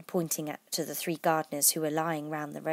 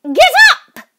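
piercing high shriek; very high, shrieking queen; shrill, loud voice; 21 dB louder than usual. Shown as a demonstration. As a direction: screech the order at full volume. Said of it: "it would have sounded something like this".

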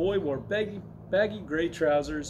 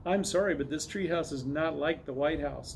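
plain reading voice

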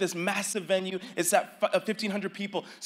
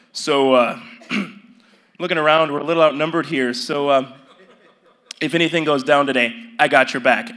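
a hint of a big man's lower voice; lightly deep voice; 6 semitones lower, 5 dB louder than usual. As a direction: drop low like a towering friendly giant. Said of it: "he says in this super deep voice".

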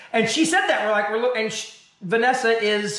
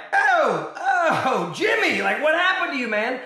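mock surprise; 6 semitones higher, slower than usual. Reacting to the actor's flat take.

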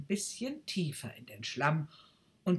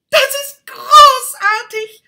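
very loud, much higher and slow; rapturous cry; 25 dB louder than usual. Acted. Rocket cries out in delight as it is set on the fire. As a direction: exult over the fire. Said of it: "rief die Rakete".

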